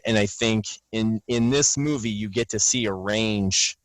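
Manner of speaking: ordinary talk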